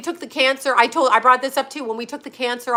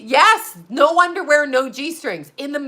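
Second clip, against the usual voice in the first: ordinary talk, nothing marked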